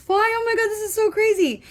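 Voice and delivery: choked up, pitch jumping way up; tearful break. Real, not acted. Reading a grief card to viewers, choking up in a high, cracking voice. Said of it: "this is gonna make me tearjerker".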